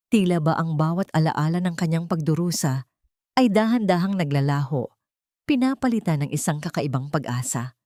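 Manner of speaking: usual speaking voice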